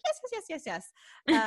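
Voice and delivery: high, rapid, eager yeses; eager agreement. Retold or imitated. Imitating Jean eagerly agreeing with the medium, high and thrilled. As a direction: nod along, thrilled.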